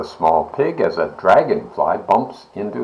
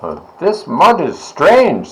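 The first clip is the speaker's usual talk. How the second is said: puzzled; 9 semitones higher than usual. A narrator's reading. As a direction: sound confused. Pig noticing hardening cement.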